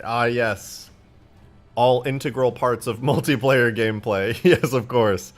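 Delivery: loud sarcastic agreement, laughing through it; amused, emphatic sarcasm; mock agreement. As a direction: heartily pretend the silly idea is brilliant. Mocking an absurd boss-fight suggestion.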